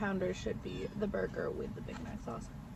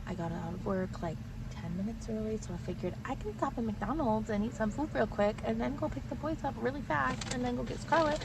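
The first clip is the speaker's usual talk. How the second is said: normal talking voice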